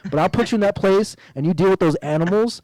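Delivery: a hint of a wrestling bad guy taunting; sneering wrestling villain. Real, not acted. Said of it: "You sound like a wrestling heel right now".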